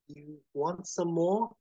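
slow, tentative rising question; uncertain. Acted. Bev hesitant into the mic before the crowd, uncertain. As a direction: feel it out, lifting hesitantly. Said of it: "says uncertainly into the mic".

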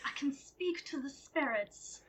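whispered mystic secret; spooky whisper. Acted. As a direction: breathe it like a secret from beyond. Psychic claims spirit contact.

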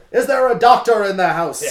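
loud, high announcement; mock call for a doctor. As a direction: call out to the crowd. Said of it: "I love the".

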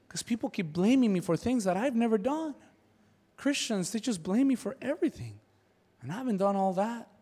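high, self-pitying complaint; mock weepy. Satan complaining to the angel about Christians, mock weepy. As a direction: play a hard-done-by sufferer, mock pitiful. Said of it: "uncontrollably sobbing".

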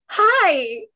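high, loud awkward greeting; nervous and dorky. Retold or imitated. Fan greeting her tall idol, nervous and dorky. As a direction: squeak out a dorky hello. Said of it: "immediately nervous and dorky".

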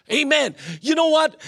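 ordinary talk, nothing marked

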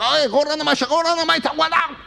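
high, garbled mock yell; imitated yelling drivers. Drivers yelling at the man blocking them, high mock yelling. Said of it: "telling me to get".

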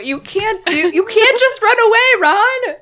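high, loud disbelief at Ron; exasperated. Real, not acted. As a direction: scold him in shocked disbelief. Scolding Ron for running away.

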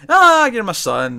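mock-gushing mom, high and loud on a drawn-out "ah"; gushing mother. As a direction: coo proudly over a son, hammed up. Retold mother melts after tasting cookie.

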